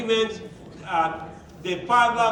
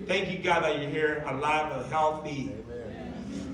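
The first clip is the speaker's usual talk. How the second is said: softer, lower prayer of thanks; 6 semitones lower, 6 dB quieter than usual. Retold or imitated. Husband repeating thanks to God, soft. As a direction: murmur grateful prayer.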